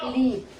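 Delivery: लीप with a drawn-out ee; लीप, ee held long; long vowel. Shown as a demonstration. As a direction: stretch the ee in लीप. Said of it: "थोड़ा लंबा उच्चारण करके".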